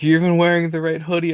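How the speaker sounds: a buddy pleased you showed up in his team's colours; friend's excited call at the airport; excited greeting. Retold or imitated.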